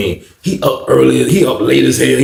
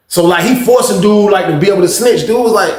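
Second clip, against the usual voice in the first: ordinary delivery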